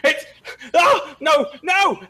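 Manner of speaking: high, loud panicked cry; terrified screaming. Acted. Game master voicing a man fleeing the darkness, screaming. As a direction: scream it out in broken bursts. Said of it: "just screaming".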